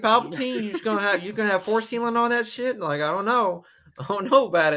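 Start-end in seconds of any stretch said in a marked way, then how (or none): 0.0-2.8 high, slowed sarcastic jab; mock scolding. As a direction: tease her with mock outrage. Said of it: "I said".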